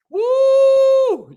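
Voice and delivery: high, loud celebratory whoop; real excited shout; hype whoop. Real, not acted. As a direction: cheer the crowd on with a big woo. Hyping the affirmation crowd.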